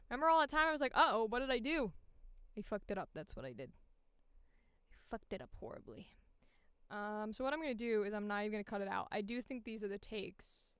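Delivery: ordinary delivery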